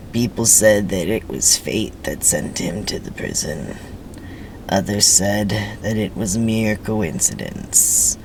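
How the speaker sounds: movie-trailer narrator musing on fate; ominous narration. A narrator's reading. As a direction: tell it like a dark fairy tale.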